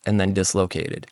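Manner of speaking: ordinary talk, nothing marked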